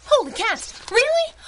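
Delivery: high, loud burst of delight; thrilled. Acted. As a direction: jump at the chance like a kid. Thrilled at offer to drive.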